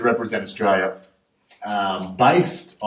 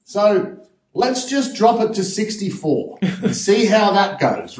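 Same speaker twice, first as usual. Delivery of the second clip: lightly voiced cocky self; 11 semitones higher, 3 dB louder than usual. Diver telling the coach his target: lightly laughing, cocky. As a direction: play it cool and cheeky. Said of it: "And I'm like".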